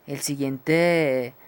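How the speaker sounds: ordinary talk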